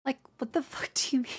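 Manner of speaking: disbelieving whisper, no voice. Real, not acted. To the chat, an exasperated whisper. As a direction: breathe it out in disbelief.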